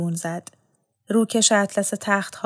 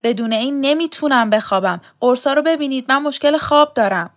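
higher, louder pleading protest; 5 semitones higher, 6 dB louder than usual. A narrator's reading. Vahab protests he can't sleep without it, pleading. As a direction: plead to keep what he needs. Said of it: "وهاب اعتراض کرد".

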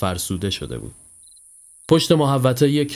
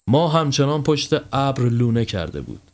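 plain reading voice

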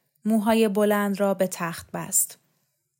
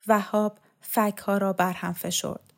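ordinary delivery, nothing marked

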